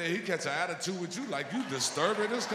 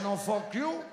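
put-on higher, snapped-back insult in a store owner's accent; mock accented retort; 3 semitones higher, slower than usual. Owner cursing back at the customer in a mock accent. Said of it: "He said".

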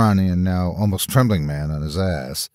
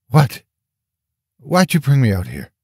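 higher, demanding questions; mildly angry, scared underneath; angry over fear; 5 semitones higher than usual. A narrator's reading. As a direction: demand answers, hiding the fear. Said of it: "I could hear my voice shaking".

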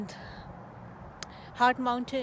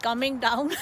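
normal talking voice

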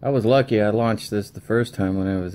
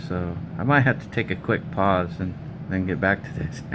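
ordinary talk

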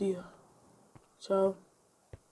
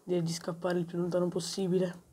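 ordinary talk

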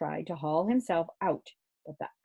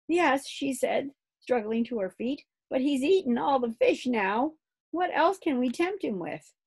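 lightly voiced girl; 8 semitones higher than usual. Kirsty getting up.

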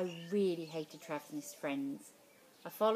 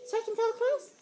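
high, far-off teacher voice; 8 dB louder than usual. Acted. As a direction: play the teacher, pitched well up.

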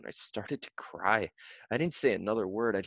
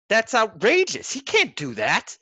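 outraged mother; 12 semitones higher, 7 dB louder than usual. A narrator's reading. Mother indignant.

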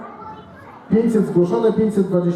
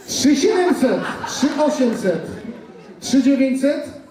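auctioneer; 7 semitones higher, slower than usual. Acted. Charity auction bidding run.

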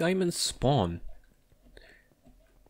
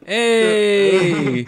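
loud greeting; 9 semitones higher, 9 dB louder than usual. Real, not acted. Greeting friend found in cave.

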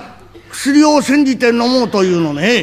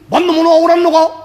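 louder, higher call from outside; stern official calling; 7 semitones higher, 5 dB louder than usual. Acted. Official calls at the door. (役人が外から呼びかける声)

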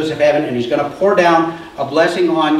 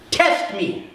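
dared challenge; 3 semitones higher, 5 dB quieter than usual. Shown as a demonstration. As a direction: lay down the dare quietly. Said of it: "throwing down the gauntlet".

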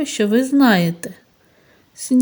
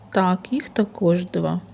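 whisper; 5 dB quieter than usual. Real, not acted. Teacher drops to whisper.

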